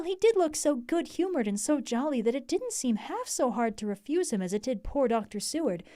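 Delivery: plain reading voice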